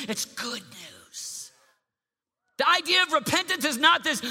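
loud, high, fervent preaching; preaching at full force. Acted. Youth pastor preaching full force to teens. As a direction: preach it out with rising joy.

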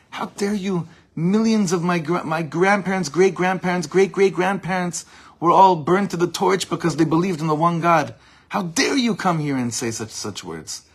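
high, loud indignant imitation; retold screaming. Teacher voicing the rabbi invoking burned grandparents, mock scream. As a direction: act out an outraged tirade at full push. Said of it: "screaming at him".

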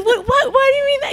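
high, squeaky disbelieving what; stunned mother asking why; shocked disbelief. Retold or imitated. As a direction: mom shocked at her son's answer, high-pitched disbelief. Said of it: "I said".

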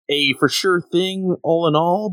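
high, quick sceptical retort; mock exasperation. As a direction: scoff at her naive wish. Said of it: "And I'm just like".

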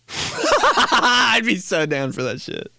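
high, slow laughing words; laughing eagerly. Real, not acted. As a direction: giggle it out, all in. Laughing, enthusing.

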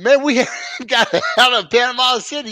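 high, laughing protest; laughing disbelief. Retold or imitated. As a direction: laugh through the retort. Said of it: "We said".

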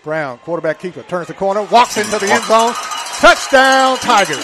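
building to a big excited yell; touchdown call. Real, not acted. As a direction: shout it as he crosses the line.

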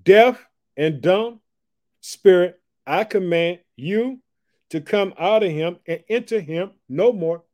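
slow, loud, high commanding rebuke; commanding Jesus. Acted. Jesus rebukes the unclean spirit, slow and commanding. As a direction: order the spirit out with authority. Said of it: "This is what he says".

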